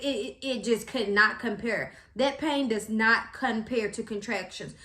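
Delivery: ordinary talk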